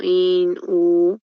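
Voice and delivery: "In" and "U" held separately, syllable by syllable; segmented syllables. Shown as a demonstration. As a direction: split off "In", then "U", each drawn out. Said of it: "quizás tú lo segmentarías así".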